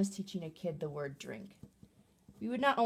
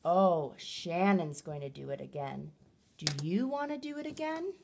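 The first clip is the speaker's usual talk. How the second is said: playful excitement. Shown as a demonstration. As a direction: build up delight for another go.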